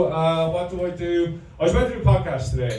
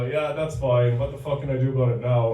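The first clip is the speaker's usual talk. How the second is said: mock resigned mutter; 3 semitones lower than usual. Mock inner reply in barber chair.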